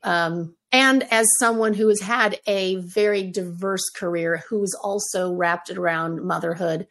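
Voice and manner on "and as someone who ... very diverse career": normal talking voice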